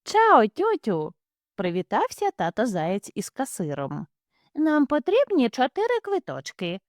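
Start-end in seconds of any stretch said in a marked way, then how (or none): 0.0-1.1 slow, higher cheery hare greeting; a silly father hare calling hello. Acted. Father hare greets the cashier, slow and cheery. 4.5-6.8 higher, prim mother hare; mother hare asking politely. Acted. Mother hare orders tickets, in a higher voice.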